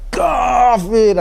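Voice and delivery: slow, hammed-up rallying call; hyped-up cry. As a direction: imitate a crowd-rousing speaker stretching the word. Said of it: "if you don't say kafir like that".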